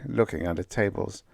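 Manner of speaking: plain reading voice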